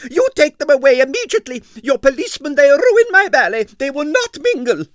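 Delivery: high, loud and fast with indignation; a prima ballerina demanding at full pitch; Arienskaya's shrill protest. A narrator's reading. Arienskaya's angry complaint.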